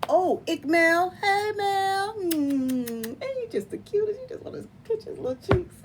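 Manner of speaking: high doting coo. Real, not acted. Cooing at a cute baby.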